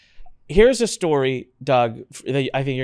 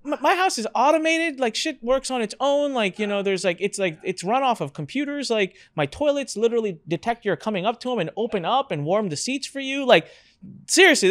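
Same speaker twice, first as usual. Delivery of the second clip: exasperated boasting; 6 semitones higher than usual. Retold or imitated. Pleading to unimpressed family.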